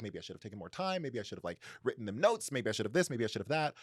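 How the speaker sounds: ordinary talk, nothing marked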